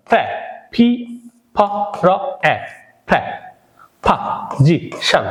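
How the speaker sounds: প্রা, পা, জি, শান broken into separate sounds; spelled out syllable by syllable. Shown as a demonstration. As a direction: sound out প্রাপোজিশন piece by piece. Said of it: "শুদ্ধ উচ্চারণ হবে".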